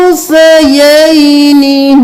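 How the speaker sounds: उन्सयैनी slow with the noon hidden and nasal; उन्सयैनी धीरे, नून को छुपाकर; ikhfa on noon. Shown as a demonstration. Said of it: "नून पे इख्फा होगा". (उन्सयैनी में नून पर इख्फा के साथ धीरे पढ़ना)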